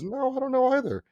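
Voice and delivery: very high imitated receptionist voice; clueless colleague. Colleague answering the receptionist, high-pitched clueless imitation.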